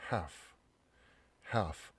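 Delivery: "half" with no L sound; "half" as haf, L dropped; silent L. Shown as a demonstration. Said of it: "L 발음이 안 나죠".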